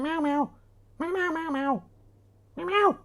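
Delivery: very high little kitten mews; squeaky falsetto meowing; imitated cat meows. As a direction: mew like a cat to lure one in. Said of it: "see if we can get the cat in".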